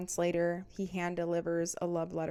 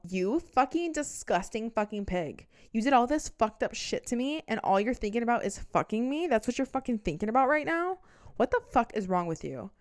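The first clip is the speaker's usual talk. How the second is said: loud, higher-pitched retold outburst; outraged tirade; 5 semitones higher, 6 dB louder than usual. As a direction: turn on him and tell him off loudly. Turns on ex, acted outburst.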